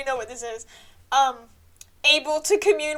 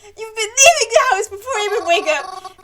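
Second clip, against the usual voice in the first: loud, higher mock-crying yell; tearful shout; 5 semitones higher, 8 dB louder than usual. Arguing with Mary about leaving the house, tearful shout. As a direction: rant while sobbing.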